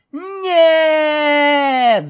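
high, long, loud squeal; playful scream. Acted. Pegasus being tickled by the stallion, squealing scream. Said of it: "закричала Скуталу, смеясь".

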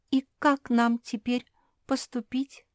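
high, plaintive wail without real sobs; mock tearful. A narrator's reading. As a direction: act on the verge of tears. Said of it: "заплакала Вруша".

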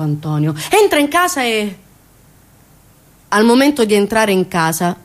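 ordinary talk, nothing marked

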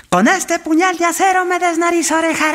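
high, wailing, overacted tongue twister; dramatic tongue twister. Villager recites a twister to the echo, high wail. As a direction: cry it out high like a melodrama. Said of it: "Cogió el paisano y dijo".

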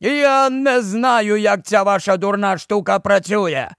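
put-on high-pitched, cross old man; angry retort. A narrator's reading. Old man grumbles about the captain's gadget, angry retort. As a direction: drag out the opening, then complain crossly. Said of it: "відповів сердито старий".

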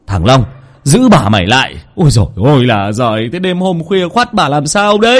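mild mock shout from the old man; scolding order. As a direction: order the servant sharply, then despair. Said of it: "ông Chí quát lên".

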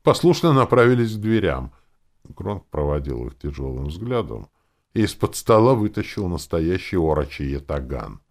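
ordinary delivery, nothing marked

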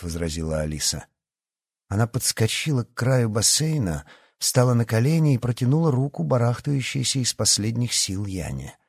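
plain reading voice

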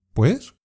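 "¿Pues?" with a sharp high rising question tune; "¿Pues?" said as a short, quick, curious question rising high at the end; asking why. Acted. Rebeca asking her friend why she can't come, rising "¿Pues?". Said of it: "Rebeca le contesta".

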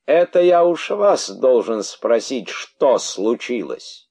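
high, loud bitter retort. Acted. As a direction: throw the question back bitterly. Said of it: "с неслыханной горечью".

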